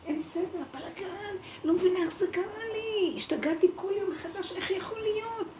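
mock your own panic, voice climbing high; frantic lament. Telling of her old chaos, a high panicked wail. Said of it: "ואז הוא אומר לי".

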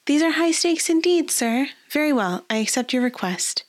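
high, mock-grave noble lady; playful lady. A narrator's reading. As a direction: accept with playful gravity.

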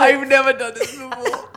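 high, giggly attempt to explain; laughter bubbling through high-pitched words; real laughing fit. Real, not acted. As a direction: explain while fighting back helpless giggles. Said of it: "I don't know why I'm laughing".

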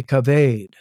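"kaved" with a long AY in the last syllable; variant pronunciation. Shown as a demonstration. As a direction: say "kaved" slowly as kuh-VAYD. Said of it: "or some say".

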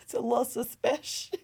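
giggling through it; laughing suspicious. Real, not acted. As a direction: repeat it while laughing. Repeating her catchphrase.

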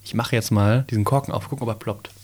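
normal talking voice

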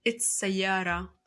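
slow, deliberate mix of English and Arabic; wrong mixed phrase. Shown as a demonstration. Mother showing the wrong way to talk to her child, slowly. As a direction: spell out the bad example slowly. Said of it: "ما بقول له".

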